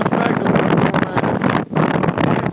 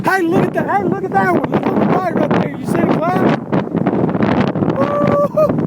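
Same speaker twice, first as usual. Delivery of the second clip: high, excited calls to look; thrilled storm chaser shouting; 9 semitones higher than usual. Real, not acted. Spotting the tornado, shouting.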